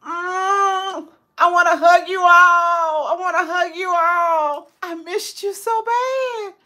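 ache with love for them; emotional, on the edge of tears; tearful joy. Real, not acted. Emotional longing to hug viewers.

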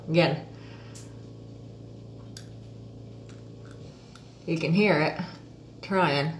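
lips pulled wide apart; fingers in mouth. Real, not acted. Seen 0: Index fingers hooked in mouth corners, stretching lips wide throughout.

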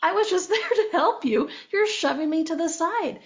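high-pitched, protesting voice of the body; the body talking. Retold or imitated. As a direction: speak up as the sidelined body, high. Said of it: "your body's like".

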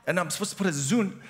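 ordinary talk, nothing marked

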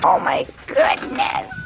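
whispered high gasp of delight; breathy squeal. Real, not acted. Sees puppies again.

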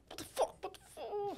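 hushed, incredulous imitation; baffled under-the-breath reaction, half laughing; mock bewilderment. Man told to say it again, hushed mock bewilderment. Acts the other man's bafflement.

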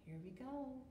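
plain reading voice

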